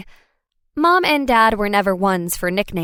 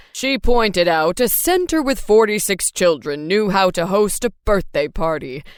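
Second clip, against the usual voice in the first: storytelling dad. A narrator's reading. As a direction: retell a favorite tale, lively. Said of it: "launched into another iteration of the tale with gusto".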